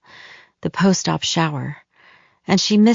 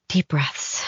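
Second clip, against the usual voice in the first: whispered; 5 dB quieter than usual. Acted. Calming herself before opening door.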